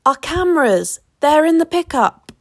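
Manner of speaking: high, urgent call; cried out. A narrator's reading. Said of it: "we cried in unison".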